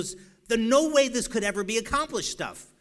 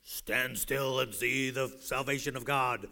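slow, lower, grand prophet voice; low, drawn-out biblical pronouncement; Charlton Heston; 4 semitones lower, slower than usual. Retold or imitated. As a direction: proclaim it slowly like an epic film hero. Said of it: "in that Charlton Heston voice".

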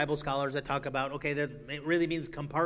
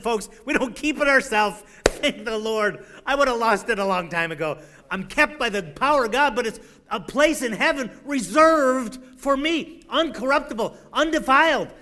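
rising, ever louder, higher preaching; preaching climax; 6 semitones higher, 7 dB louder than usual. Acted. Preacher rousing his congregation about heaven, building to a shout. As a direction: build the praise toward a shout.

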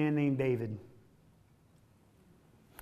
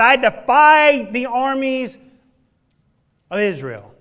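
Goliath's defiance; 5 semitones higher, 8 dB louder than usual. Acted. Voicing Goliath's defiance again.

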